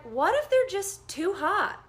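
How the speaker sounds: high, loud, slow burst of realization; sudden realization. Retold or imitated. Nurse realizing patient may be too hot, loud excited burst. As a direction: blurt out the idea that just hit you.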